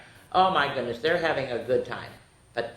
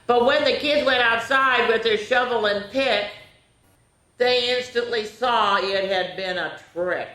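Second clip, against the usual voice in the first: high, animated read-aloud; story narration; 7 semitones higher, 4 dB louder than usual. A narrator's reading. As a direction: read it with suspense for kids.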